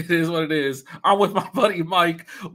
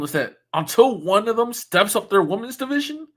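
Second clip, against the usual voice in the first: ordinary delivery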